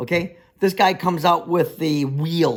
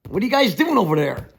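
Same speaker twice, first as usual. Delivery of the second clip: mock challenge, raised pitch and voice; confrontational call; 8 semitones higher, 4 dB louder than usual. Builder to nosy onlookers, confrontational call. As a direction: call out sharply to chase them off. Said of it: "I don't directly say".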